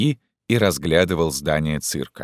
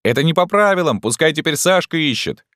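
put-on child's shout; 10 semitones higher than usual. A narrator's reading. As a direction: shout like a kid in a game. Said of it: "кто-то кричал".

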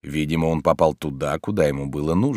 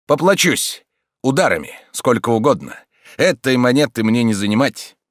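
higher and louder, cocky taunts; mocking fighter; 5 semitones higher, 4 dB louder than usual. A narrator's reading. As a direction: taunt him while fighting. Stranger mocks during fight. (насмешливый боец, громче и выше)

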